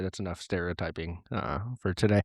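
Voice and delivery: ordinary talk, nothing marked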